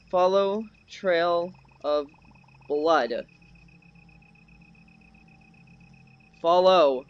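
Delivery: slow, separated words; deliberate instruction. Real, not acted. Instructing teammates on the blood trail, slow and deliberate. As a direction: give the command one word at a time. Slowly instructing the other players.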